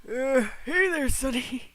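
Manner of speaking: put-on groaning old man with a cane. Mocking a character walking with his cane, old man voice. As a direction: put on a creaky old-timer greeting. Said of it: "walking with his cane like".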